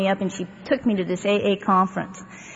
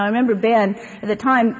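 usual speaking voice

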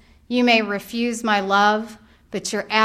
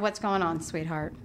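gentle; 5 semitones lower, 10 dB quieter than usual. Retold or imitated. Mother comforting crying son.

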